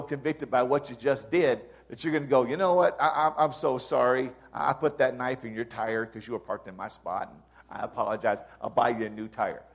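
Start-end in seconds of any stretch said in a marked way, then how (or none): 2.4-9.6 sorry churchgoer. Retold or imitated. Churchgoer to neighbour at settling up time, sheepish apology. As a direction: own up meekly to a neighbour. Churchgoer confessing slashing a tire.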